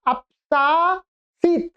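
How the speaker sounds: a slow teaching model of अपसासीत; slow, drawn out. Shown as a demonstration. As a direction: spell out अपसासीत syllable by syllable. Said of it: "क्या?".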